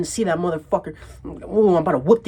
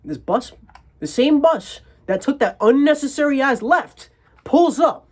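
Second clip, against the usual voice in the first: rising anger; 7 semitones higher, 5 dB louder than usual. Real, not acted. Angry build-up.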